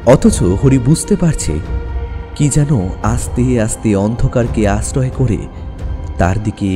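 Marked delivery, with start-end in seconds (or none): none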